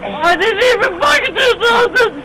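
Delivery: sobbing, yelled protest of innocence; real sobbing, pleading you did nothing; crying plea. Real, not acted. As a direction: cry it out, desperate and hurt. Man pleads while tasered, crying.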